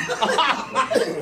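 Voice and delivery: quick high chuckle; short laugh. Real, not acted. Laughing while telling his friend the story.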